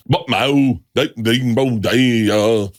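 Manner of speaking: slow, drawn-out mock Vietnamese gibberish; badly faked Vietnamese speech; fake Vietnamese. Shown as a demonstration. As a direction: imitate Vietnamese tones with nonsense syllables. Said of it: "real good fake Vietnamese".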